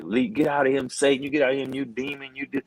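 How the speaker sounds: a light take on a praying wife ordering Satan out; imitating the praying wife. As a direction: voice the wife commanding the demon to leave. Wife praying out loud on phone.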